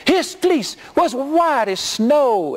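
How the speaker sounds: slow, high, preachy chant of the rhyme; mock preacher shout. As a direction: stretch the rhyme out like a revival climax. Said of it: "he started going through this".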